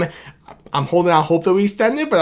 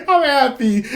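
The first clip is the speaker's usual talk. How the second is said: pitched much higher, giddy with joy; excited; 9 semitones higher than usual. Real, not acted. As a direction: let the happiness burst out high. Repeats I'm happy twice.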